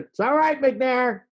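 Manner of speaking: high holler from far down the hall; distant shouted reply. Retold or imitated. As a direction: call back loudly across the building. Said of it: "from the other end of the building".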